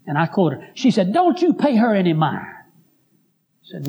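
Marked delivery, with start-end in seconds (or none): 1.1-2.5 higher-pitched, retold brush-off; outspoken dismissal. Grandma telling preacher to ignore her daughter, acted dismissal. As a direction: imitate a plain-spoken grandma waving it away. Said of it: "she is a little outspoken".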